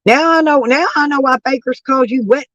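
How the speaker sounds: ordinary delivery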